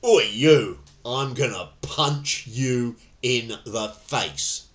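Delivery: loud threatening "Oi, you", slowing on "punch you in the face"; aggressor's threat. Shown as a demonstration. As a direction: bark it like a thug picking a fight. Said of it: "with some colourful words thrown in".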